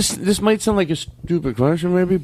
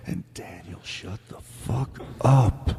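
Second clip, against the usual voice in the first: low, slow, very soft telling-off; hushed rebuke; 8 semitones lower, 7 dB quieter, slower than usual. Acted. Host telling Daniel off in an aside, hushed rebuke. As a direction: drop it right down under your breath.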